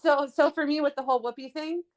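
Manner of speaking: usual speaking voice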